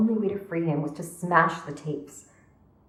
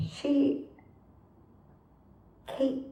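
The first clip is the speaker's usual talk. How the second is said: high, soft, grief-struck; small high voice, breaking off; halting confession; 9 semitones higher, 4 dB quieter than usual. Acted. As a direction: struggle to get the name out.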